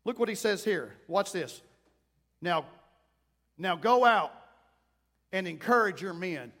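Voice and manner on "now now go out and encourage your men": sudden bold order, loud on now go; bold command. Acted. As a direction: take charge and order him out. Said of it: "this man got bold for a minute".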